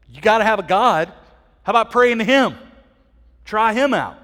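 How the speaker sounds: hammed-up pleading sailors, pushing hard; mock urgent sailors. Sailors shouting at sleeping Jonah to pray, hammed-up and loud.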